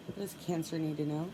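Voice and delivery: ordinary talk, nothing marked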